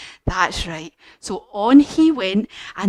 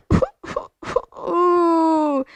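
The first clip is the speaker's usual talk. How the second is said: high playful mock sob; pretend cry; 7 semitones higher than usual. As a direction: fake a wee cry for the kids. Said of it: "have a wee cry".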